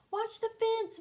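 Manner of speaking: loud high warning shout; yell. Acted. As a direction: call it across the field. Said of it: "Buster yelled".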